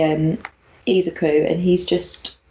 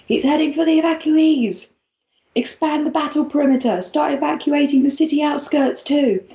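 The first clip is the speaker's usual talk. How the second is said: Endeavour shouting orders; 6 semitones higher than usual. Acted. Hero ordering evacuation over his headset, urgent acted shout. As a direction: bark orders down a headset, pitched up. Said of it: "as Endeavour shouts".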